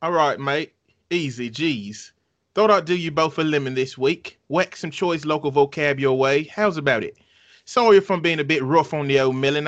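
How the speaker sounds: put-on amateur British accent, no Cockney; chummy attempt at a British accent. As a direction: try on a light British accent reading a letter. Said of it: "I gotta try my British accent".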